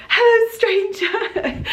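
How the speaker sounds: high, slow sing-song hello; teasing greeting. Retold or imitated. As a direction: coo it at an old friend. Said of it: "I was like".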